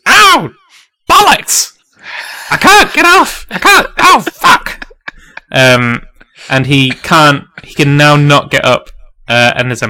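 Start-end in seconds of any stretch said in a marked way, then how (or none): 0.1-4.9 high, loud cries of pain; yell, pinned under a boulder. Acted. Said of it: "and he goes".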